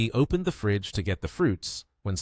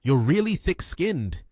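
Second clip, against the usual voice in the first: higher-pitched, mildly angry telling-off; angry scolding; 7 semitones higher, 3 dB louder than usual. A narrator's reading. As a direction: scold him in a raised pitch. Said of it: "and he scolded him".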